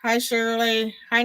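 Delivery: ordinary talk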